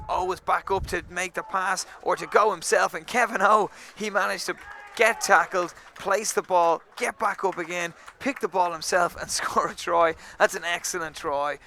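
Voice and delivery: thrilled play-by-play of the score; excited call. Real, not acted. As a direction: call the try as it happens. Calling a try live.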